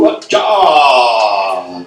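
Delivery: loud shouted wah-cha; mock shouted call. Hunter imitating a strange call from the woods. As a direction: bark out a wild call.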